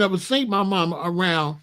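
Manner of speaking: normal talking voice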